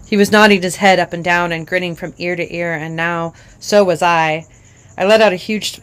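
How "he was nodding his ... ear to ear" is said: ordinary delivery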